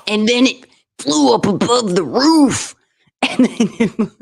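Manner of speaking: wrestler voice. Pretend. Cohost pictures the philosopher talking like a hyped-up wrestler. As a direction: tell it like a pro wrestler hyping a match. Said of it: "I wish he talked like a wrestler".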